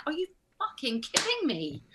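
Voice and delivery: soft, incredulous aside; exasperated disbelief. Retold or imitated. Actor after a stranger walks in, incredulous under her breath. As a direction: mutter it in retold disbelief.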